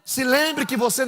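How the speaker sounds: loud, high, half-sung preaching cry; full-force preaching. Acted. Preacher proclaiming faith to the church, loud and sung. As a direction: chant it over the music at full force. Preaching climax over music.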